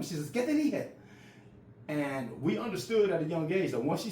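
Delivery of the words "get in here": high-pitched mother's order, imitated; stern mom. Mom cutting off her son's pleading, high imitated order. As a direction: order the kid inside like mom.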